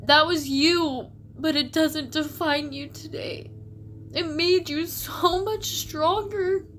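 high, slow and tearful, close to crying; voice breaking. Real, not acted. [name] reassuring her younger self, voice breaking with tears. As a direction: comfort your past self through rising tears. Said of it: "I'm sorry".

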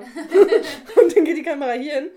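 talking through laughter; laughing. Real, not acted. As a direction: laugh while you explain. Laughing while explaining the shot.

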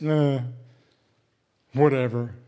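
jaded, drawn-out shrug of a word; bored dismissal. Pretend. Cynical youth shrugging everything off, bored dismissal. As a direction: brush it off like a cynic. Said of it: "everything's just".

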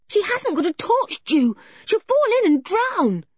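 put-on high, alarmed child's voice; high-pitched, frantic warning; panicked yell. A narrator's reading. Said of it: "yelled George in a panic".

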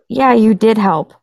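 ordinary talk, nothing marked

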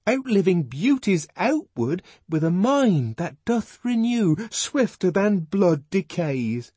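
put-on high, earnest young man's voice, pleading; John the Savage. John pleading with Lenina, high and fervent.

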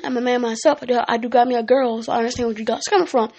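ordinary talk

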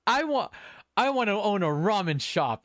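high-pitched mock yell of a kid's outburst; retold yell. Kid answers the villain's question about hope, yelling out. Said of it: "he just yells out".

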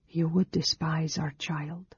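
soft, understated tearful voice. A narrator's reading. Woman grieving over their child, softly tearful. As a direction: hold back tears, speaking softly. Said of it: "her eyes filled with tears".

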